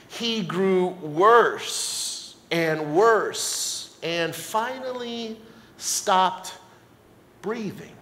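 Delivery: slow, hushed, weighty telling; solemn scripture reading. Acted. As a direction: let each phrase fall slowly and softly. Reads verse with grave drama.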